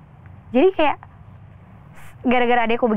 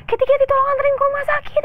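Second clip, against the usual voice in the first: much higher, urgent imitated plea; panicked plea. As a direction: imitate the friend begging for a ride in panic. Friend panics after mother's death. (teman panik memohon diantar ke rumah sakit, suara jauh lebih tinggi)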